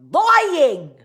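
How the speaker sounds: loud, drawn-out accusation, exaggerated accent; hammed-up disbelief; incredulous retort. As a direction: stretch "lying" into a loud cry. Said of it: "I was like".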